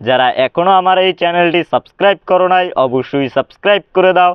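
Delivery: ordinary talk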